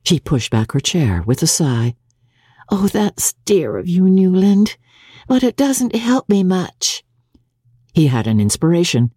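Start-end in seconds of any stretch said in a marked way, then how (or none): 2.7-7.0 put-on raised young wife's voice, fond but let down; grateful yet still unhelped; May, sighing. Young wife sighs, pushing back her chair from husband.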